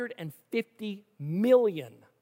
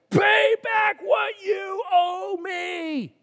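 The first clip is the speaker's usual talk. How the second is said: high, loud, slow furious shout; angry shout; 10 semitones higher, 5 dB louder, much slower than usual. A narrator's reading. Servant choking fellow servant, furious shout. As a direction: roar the demand while choking him. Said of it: "screaming at him".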